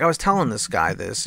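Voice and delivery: usual speaking voice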